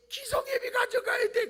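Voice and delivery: put-on high, loud tearful child's plea; mock a child pleading through tears; crying child. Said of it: "이". (높고 크게 울먹이는 아이 흉내)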